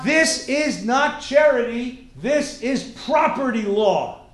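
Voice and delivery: slow, loud, hammered words; emphatic declaration. Real, not acted. Teacher correcting students' idea of charity, emphatic declaration. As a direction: hit "not charity" and "property law" hard. Hammers home the lesson's point.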